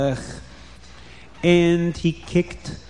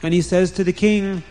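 plain reading voice

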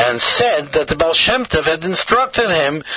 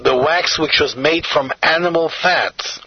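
plain reading voice

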